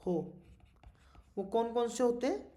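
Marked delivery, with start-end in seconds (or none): 0.0-0.1 slow dictation with long pauses between words. Acted. Teacher dictating a definition to students, slow word-by-word dictation. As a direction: dictate it piece by piece, drawing out words.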